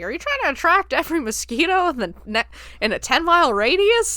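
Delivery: mock complaint about the blinding porch light; the boss squawks about the porch light, hammed-up; fussy boss.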